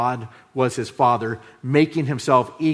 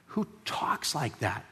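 awed hush; 12 dB quieter, slower than usual. Real, not acted. Awed rhetorical question.

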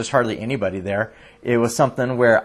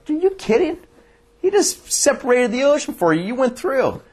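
much higher, exaggerated disbelief; incredulous mockery; 11 semitones higher than usual. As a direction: scoff at them, are you serious. Mocking the doubting Israelites.

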